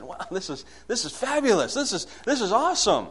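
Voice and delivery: high-pitched delighted exclamation; retold amazement. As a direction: relive the thrill of a discovery. Retells his own amazed reaction.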